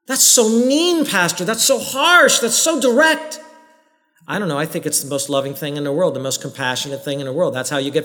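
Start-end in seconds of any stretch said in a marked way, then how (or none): 0.1-3.4 whiny, higher-pitched protest aimed at the pastor; mock complaint. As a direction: play an offended churchgoer whining at the pastor.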